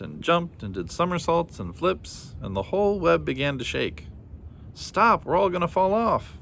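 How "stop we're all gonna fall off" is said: high panicked cry; screaming as the web shakes. A narrator's reading. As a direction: ant screaming in fear. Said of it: "screamed the ant".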